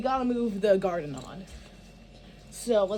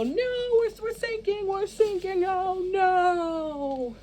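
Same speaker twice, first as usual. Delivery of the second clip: very high, loud shriek of panic; panicked sinking; 9 semitones higher, 6 dB louder, much slower than usual. Shown as a demonstration. Witches trapped by their own spell, high panicked cry. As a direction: cry out as the ground swallows you. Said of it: "and you go".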